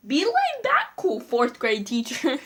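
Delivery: high, slow mock laid-back voice; mock chill. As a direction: tease the teacher with a lazy drawl. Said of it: "wasn't laid back cool".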